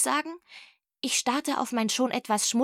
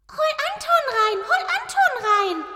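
very high, insistent cat chant; piercingly high, louder cat demand; 4 dB louder than usual. Acted. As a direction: nag like a cat, squeakily repeating it. Cat's insistent thought, chanted.

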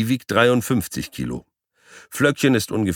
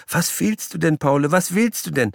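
high, asking sweetly; high coaxing woman; 11 semitones higher than usual. Acted. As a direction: coax a pet, lightly voiced. Owner asks the guinea pig.

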